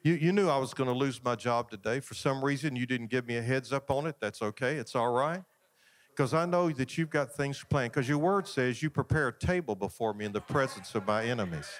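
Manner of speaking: calm, low-pitched talk to a Father; acted trusting prayer. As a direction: pray it evenly and unhurried, accepting the bad news. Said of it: "walk out of there and say".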